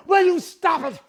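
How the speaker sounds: normal talking voice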